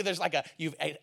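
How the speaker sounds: ordinary talk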